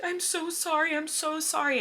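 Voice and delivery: high, loud mock-wailing apology; tearful apology. Crying passenger apologizing to the whole plane, high wail. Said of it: "I was just, like, apologizing".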